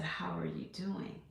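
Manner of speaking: a hushed, breathy phone greeting; mother whispering hello to her daughter; soft whisper. Retold or imitated. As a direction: whisper the question gently. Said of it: "I wanna say that again".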